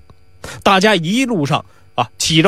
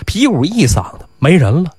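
plain reading voice

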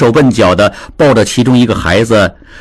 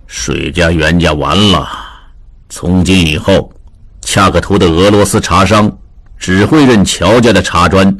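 lower, heavy resigned verdict; sighing gloom; 6 semitones lower than usual. A narrator's reading. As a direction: sigh it out as a hopeless conclusion. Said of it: "邱天俊叹了一口气". (叹气着低沉地说)

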